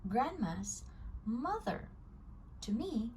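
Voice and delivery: ordinary delivery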